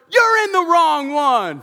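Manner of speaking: loud, high, stretched-out holler; retold shout. Lady at the bathroom door to deaf veteran, imitated slow shout. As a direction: shout it slowly for someone hard of hearing.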